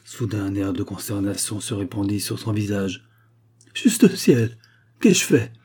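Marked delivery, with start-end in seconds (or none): 3.7-5.5 higher and louder, a stricken cry; horrified cry. Acted. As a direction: gasp it out in sudden horror at her own mistake. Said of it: "s'écria-t-elle". (cri d'effroi, voix haute et forte)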